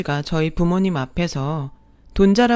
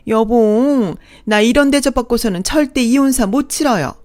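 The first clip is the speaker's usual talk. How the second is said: higher and louder indignant screech; shrill outraged second mother; 5 semitones higher, 6 dB louder than usual. Acted. Second mother outraged at the bride, shrieking indignantly. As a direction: throw a tantrum at the bride.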